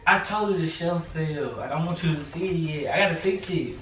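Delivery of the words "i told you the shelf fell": ordinary talk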